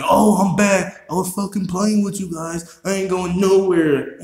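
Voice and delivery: hammed-up taunting impression of a boastful rapper. Mocking [name]'s comeback.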